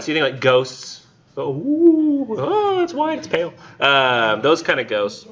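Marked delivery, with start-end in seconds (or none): 1.4-3.5 high, hammed-up ooh at a ghost; mock spooked gasp. Teacher acting out a ghost sighting for students, mock spooked. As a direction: squeal it like you've just seen a ghost.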